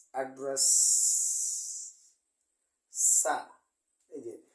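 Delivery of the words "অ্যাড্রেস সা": অ্যাড্রেস with a long hissed সা, loud; mock American s. Shown as a demonstration. Teacher mocking the American hissed s. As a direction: hiss the end of অ্যাড্রেস like a joking American. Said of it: "আমেরিকানরা একটু এটাকে মজা করে বলে".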